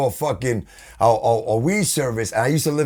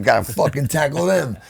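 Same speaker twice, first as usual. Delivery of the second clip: ordinary talk, nothing marked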